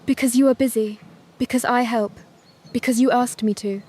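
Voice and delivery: plain reading voice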